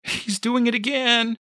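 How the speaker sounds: very high alarmed cry; panicked. A narrator's reading. Mother calls boyfriend about baby, high panicked cry. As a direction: report it in rising panic.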